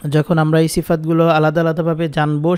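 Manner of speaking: normal talking voice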